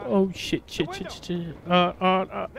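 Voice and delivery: low, soft string of curses; panicky muttering. Real, not acted. Player under fire hunting for a window, panicky muttering. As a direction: mutter it under the breath, trailing away.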